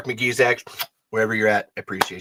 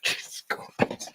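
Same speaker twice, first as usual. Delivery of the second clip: whispered oath; 12 dB quieter, slower than usual. Real, not acted. They don't all work.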